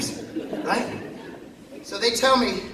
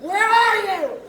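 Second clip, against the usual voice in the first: calling out; 10 semitones higher, 6 dB louder, slower than usual. Retold or imitated. Calling to a higher power.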